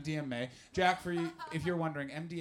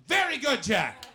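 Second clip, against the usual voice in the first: loud praise; 8 semitones higher, 9 dB louder, slower than usual. Real, not acted. Praising audience member loudly.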